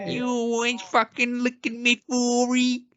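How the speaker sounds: high, slow, nose-pinched bogan threat; showing how he shuts his nose for the bogan voice; nasal bogan voice. Pretend. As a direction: close the nose with the upper lip and whine. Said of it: "use my upper lip to close my nose".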